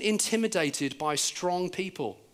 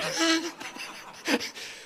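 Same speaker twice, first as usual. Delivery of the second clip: high, breathy laugh after a punchline; 11 semitones higher, 3 dB quieter than usual. Real, not acted. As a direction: let out a high, voiceless chuckle. Preacher laughs after his punchline.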